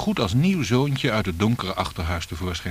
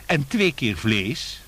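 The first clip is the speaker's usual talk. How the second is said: put-on slow, higher child's question; hasty boy's call; 4 semitones higher, much slower than usual. A narrator's reading. Boy squeezes in one more question to the guest, calling out. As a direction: pipe up with drawn-out words. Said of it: "riep hij nog haastig".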